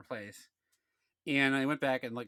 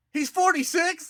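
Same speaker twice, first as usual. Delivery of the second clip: high, loud incredulous outburst; acted indignant shout; retold yell; 14 semitones higher, 7 dB louder, slower than usual. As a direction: yell it into the phone in disbelief. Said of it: "I yelled it into the phone".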